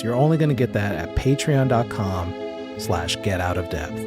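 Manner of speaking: usual speaking voice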